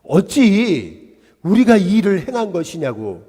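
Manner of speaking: loud acted remonstrance; retold shouting. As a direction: imitate an apostle crying out to a crowd. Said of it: "소리 질러서 얘기합니다".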